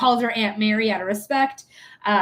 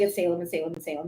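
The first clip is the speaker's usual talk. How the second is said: much softer, lower, like a gut feeling; hushed inner voice; 7 semitones lower, 8 dB quieter, much faster than usual. Retold or imitated. Recalling her intuition about which cat was sick, hushed and low. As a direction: murmur it as an inner knowing. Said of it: "It was like".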